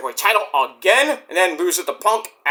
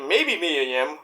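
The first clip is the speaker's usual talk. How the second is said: ordinary talk, nothing marked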